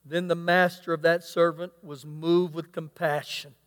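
normal talking voice

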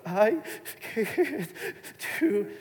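high, halting, broken-up words; mock-frightened little voice, long breaks; shaking toddler. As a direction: imitate a tearful child forcing out each word. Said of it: "literally shaking, shaking more".

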